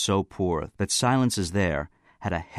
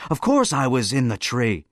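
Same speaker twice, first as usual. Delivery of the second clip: raised, emphatic exasperation; exasperated; 5 semitones higher, 5 dB louder than usual. A narrator's reading. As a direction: insist on the obvious, exasperated. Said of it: "in an exasperated chuckle".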